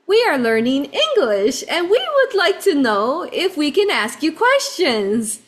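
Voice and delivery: imitating little kids, high sing-out delivery; Japanese children's English. Little boys practicing English on tourists, high careful accented voice. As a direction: recite it like a young English learner, high-pitched. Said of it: "They have an accent speaking English".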